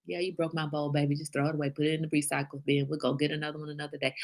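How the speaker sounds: soft, low, unbothered mom shrug; relaxed, hushed letting-it-go voice; easygoing pandemic parenting. Acted. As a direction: brush it off quietly as no big deal. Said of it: "Pandemic parenting is like".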